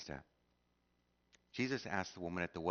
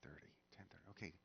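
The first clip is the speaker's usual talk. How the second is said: whispered aside; 16 dB quieter than usual. Real, not acted. Aside checking the time.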